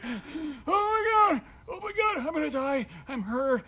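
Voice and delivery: high, slow, strained wail of pain; mock agony, voice squeezed up high; hammed-up oh my God I'm dying; panicked pain cry. Retold agony after fall.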